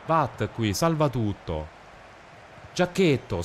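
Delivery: ordinary talk, nothing marked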